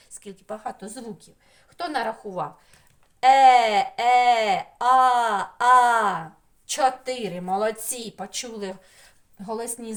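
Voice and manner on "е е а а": each vowel loud, held and separate; vowels sounded out. Shown as a demonstration. Teacher counting vowel sounds with children, sounded out loud. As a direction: ring out Е, е, а, а one at a time.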